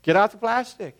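high, urgent mock panic; hammed-up desperation over the deadline. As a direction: pastor and Paul caving to the credit card, lightly mock-panicked. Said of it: "Paul and I were like".

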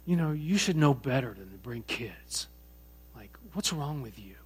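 low, hushed retold reproach; in-your-face scolding. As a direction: imitate a quiet, confrontational telling-off. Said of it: "She gets into my daughter's face and says".

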